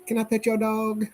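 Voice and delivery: high, sing-song mimicry of a dog-petter; put-on high voice, mocking; mock pleading stranger. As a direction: imitate an over-eager dog lover in a high pitch. Imitating a woman asking to pet dog.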